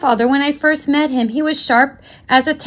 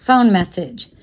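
ordinary delivery, nothing marked

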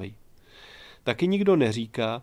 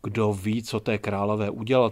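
ordinary delivery, nothing marked

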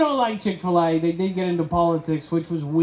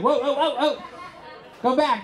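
mock startled, higher; whoa, go back, higher and startled; 8 semitones higher than usual. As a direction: pull up short, alarmed. Startled reaction acted out.

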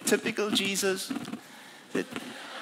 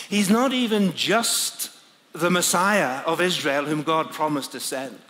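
ordinary delivery

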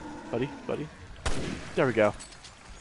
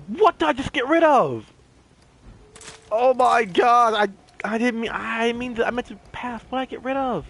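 high-pitched, distressed disbelief; shocked; 7 semitones higher than usual. Real, not acted. Player has discarded an unknown item by mistake, shocked. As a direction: exclaim in dismay at your own blunder. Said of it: "I'm actually shocked".

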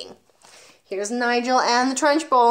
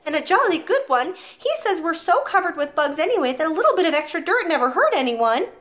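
put-on 1 small boy voice; 8 semitones higher, 4 dB louder than usual. Boy defends his father.

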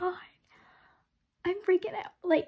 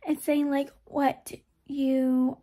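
ordinary talk

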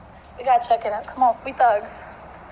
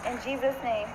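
quiet prayer; 8 dB quieter than usual. Real, not acted. Quick prayer before reveal.

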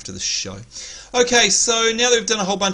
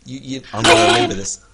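mock threat; 12 semitones higher, 3 dB louder, faster than usual. Mock threat after losing the vote.

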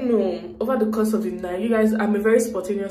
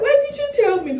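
much higher, louder mock mom; imitating angry mom; 4 dB louder than usual. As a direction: squeal it like her scolding mom. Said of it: "she'll be like".

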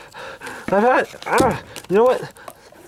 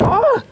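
sharp high-pitched yelp; 13 semitones higher than usual. Acted. Yelp when something thrown.

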